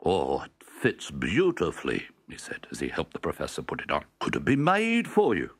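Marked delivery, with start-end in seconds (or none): 0.0-2.1 slow, pleased tailor voice; a tailor admiring his work, slowly; pleased old tailor. Acted. Tailor praises the jacket. 4.2-5.5 higher, eager question; the shop scene, a higher hopeful question; hopeful character voice. Acted.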